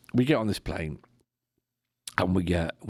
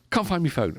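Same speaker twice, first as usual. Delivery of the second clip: higher, frantic imitation of the wife; mock panic; 9 semitones higher than usual. Wife has lost her phone on the plane, high mock panic. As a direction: play her flustered panic. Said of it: "So she's panicking".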